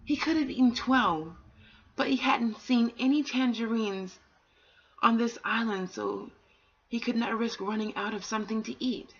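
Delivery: put-on gentle read-aloud for children; a calm storyteller reading aloud; storybook reading. A narrator's reading. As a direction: tell it like a bedtime story.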